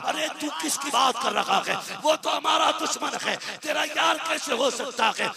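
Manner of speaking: high, shocked outburst as the father; father recoils in disbelief, voice up high; outraged father. Acted. Father hears his son praise the Prophet, shocked high outburst.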